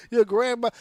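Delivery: laughing through the words; cracking up while repeating. Real, not acted. Host laughs at cohost's grandma joke, laughing. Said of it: "Your grandmother's wrestling".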